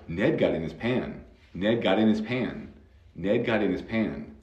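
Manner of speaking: natural connected speech. Shown as a demonstration. Teacher to student, showing fast natural speech. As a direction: run the words together at normal speed, dropping the H in his. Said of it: "si estamos hablando rápidamente".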